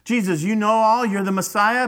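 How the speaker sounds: high, loud acted taunt; mocking. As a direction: jeer at his supposed powers. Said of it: "They were mocking".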